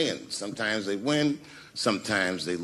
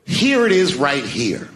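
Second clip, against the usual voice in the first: confident claim; 7 dB louder, slower than usual. Retold or imitated. Confident pick at table.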